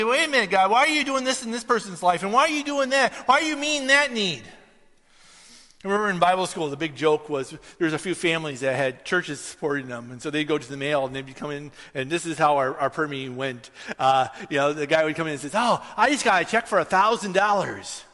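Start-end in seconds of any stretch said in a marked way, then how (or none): 0.0-4.4 high-pitched, insistent questioning of God; mock-aggrieved demand for an explanation; indignant complaint. 15.5-18.0 pleased announcement. Retold or imitated. Voicing a boasting classmate.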